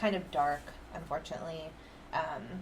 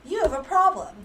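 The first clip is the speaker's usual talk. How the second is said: high, loud mock scolding; exaggerated ex-partner's complaint; mock accusation; 10 semitones higher, 10 dB louder than usual. Acted quote of accusing ex.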